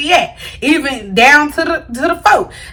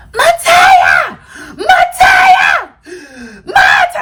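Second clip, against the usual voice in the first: frantic yelling; much slower than usual. Retold or imitated. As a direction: scream the name like someone's in danger. Friend screaming her name at night.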